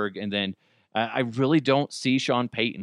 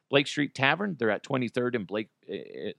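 ordinary delivery

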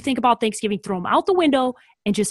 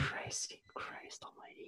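aghast unvoiced whisper; 29 dB quieter, slower than usual. Real, not acted. Cohost groaning at the hot take in a whisper. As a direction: mutter it breathlessly in dismay.

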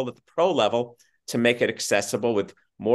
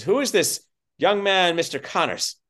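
imitated grand actor, pitched up; Richard Burton; 7 semitones higher than usual. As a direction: pose the question in a mock stage voice. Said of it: "he had the booming voice".

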